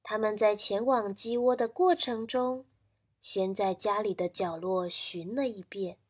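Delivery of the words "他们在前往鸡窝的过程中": ordinary delivery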